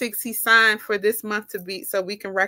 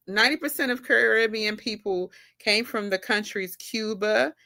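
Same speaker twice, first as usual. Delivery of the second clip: usual speaking voice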